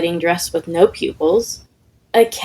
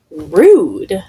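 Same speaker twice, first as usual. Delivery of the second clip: a louder, put-out ghost sniff; offended ghost; 4 dB louder than usual. Acted. Ghost objects to being exorcised, indignant. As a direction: take offense, clipped and loud.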